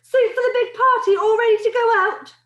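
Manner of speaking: high, loud kitchen call; shouted. A narrator's reading. Cook announcing the party soup is ready, shouted. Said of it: "and shouted".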